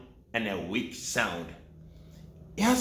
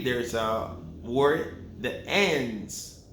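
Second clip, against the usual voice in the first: ordinary delivery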